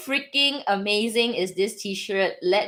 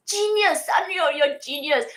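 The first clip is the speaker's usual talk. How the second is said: high, slow gushing praise; excited praise; 8 semitones higher than usual. Real, not acted. Praising Sanrio's collab to viewers, high gushing. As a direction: rave about the genius collab.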